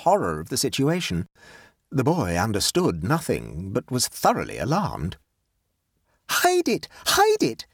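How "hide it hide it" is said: very high, urgent panicked plea; frantic. Acted. Nora pleads with the boy to hide the evidence, frantic. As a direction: beg in panic, pitched right up. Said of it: "said Nora frantically".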